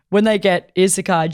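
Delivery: ordinary talk, nothing marked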